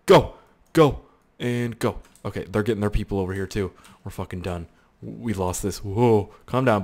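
usual speaking voice

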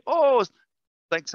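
a hammed-up high, loud "oh" of delight; squeaky surprised gratitude; mock surprised thanks. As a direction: play the guest pleasantly caught off guard. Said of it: "And they'd be".

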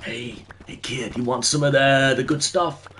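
lightly voiced shady candy pusher; a hint of a creep luring kids; weird candy man. Streamer imitating a trench-coat man offering kids candy, mock creepy. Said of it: "one of those weird guys".

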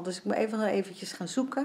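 normal talking voice

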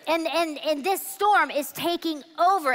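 normal talking voice

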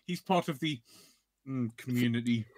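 imitated disdain with a pause before "community"; teasing, disdainful pause before "community"; mock disdain. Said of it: "you say it with such disdain".

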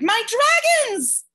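very high, loud, slow delighted cry; boy crying out in delight; cried out with joy. Acted. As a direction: shout with wonder at the dragons. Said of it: "Charles Wallace cried".

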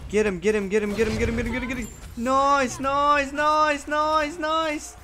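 high, rapid cheering chant; excited cheering. Real, not acted. Cheering a kill in game.

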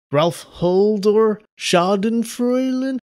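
high, slow, stretched-out pompous announcing; lightly voiced herald calling out names; grand name reading. Thanking patrons, lightly hammed-up herald calling names.

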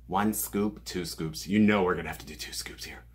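fast, breathy conspiratorial whisper. Real, not acted. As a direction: let them in on a little secret. Whispering about double scoop.

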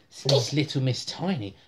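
plain reading voice

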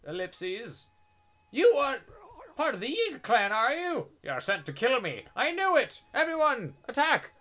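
high, loud hammed-up old villager; hammed-up high old man accusing loudly; old man NPC voice. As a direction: squawk it out like a panicky old man. Voicing old man NPC dialogue.

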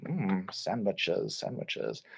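low, soft and slow savouring; yummy. Shown as a demonstration. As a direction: savour the word like tasty food. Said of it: "It was very yummy".

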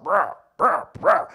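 croaky frog-like "bruh"; frog croak. Retold or imitated. Preacher mocking students' slang, croaking like a frog. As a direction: croak it out in short bursts like a frog. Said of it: "You sound like frog".

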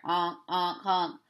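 low honk honk; mock goose call. Goose honks.

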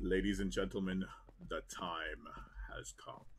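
low, soft, sinister announcement; villain voice. Acted. Gamer announcing the moment, in a low villain voice. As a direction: lure the crowd in like a villain. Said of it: "I really wanna try voice acting".

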